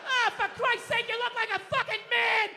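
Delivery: high, loud mock outrage at mom's haircut; dad shouting at mom in the car; dad yelling. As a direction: bark it like a blunt dad. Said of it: "he just goes".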